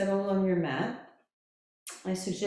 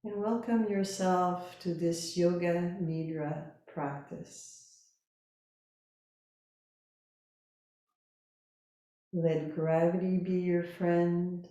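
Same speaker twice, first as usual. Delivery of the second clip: slow, calm Yoga Nidra guidance with long pauses; guided meditation; 3 semitones lower, 4 dB quieter than usual. Real, not acted. Yoga teacher settling listeners lying down, calm guided meditation. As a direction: guide them gently into rest, unhurried.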